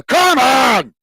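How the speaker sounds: loud, high celebratory yell; excited shout. Real, not acted. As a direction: let out a drawn-out fan's roar. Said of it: "I'm getting a bit excited".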